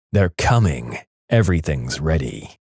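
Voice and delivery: low, grave repetition; ominous. A narrator's reading. Sleeper answering puzzled wife, ominous. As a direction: state it low and certain.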